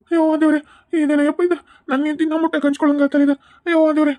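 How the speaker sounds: exasperated wail. Acted. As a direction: throw up your hands to God. Husband despairs at haunting.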